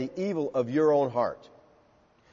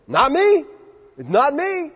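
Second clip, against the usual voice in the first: high, louder, insistent "not me"; quick denial; 9 semitones higher, 4 dB louder, slower than usual. Acted. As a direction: brush off the accusation eagerly. Disciples quickly deny betrayal.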